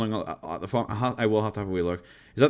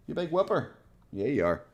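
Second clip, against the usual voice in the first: higher, soft pet voice to the dog; talking to the dog; 3 semitones higher, 6 dB quieter than usual. Real, not acted. Streamer coos at her husky, higher and softer. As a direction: coo at the dog teasingly.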